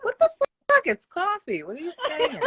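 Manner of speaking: high, loud, baffled outburst; mock shock. Reacting to her friend's New York coffee in disbelief. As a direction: act stunned by how she says coffee. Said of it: "I'm like".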